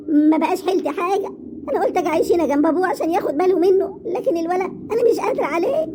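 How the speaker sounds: high, plaintive complaint; helpless wail. Acted. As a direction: lament rising high at the end. Complains helplessly about her unruly son.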